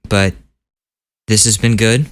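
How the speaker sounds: normal talking voice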